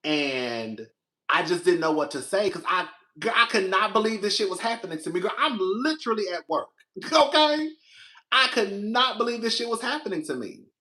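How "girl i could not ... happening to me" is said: higher, fast, animated; reliving the disbelief; exasperated disbelief. Real, not acted. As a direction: tell it still shocked. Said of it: "I was so shocked and confused".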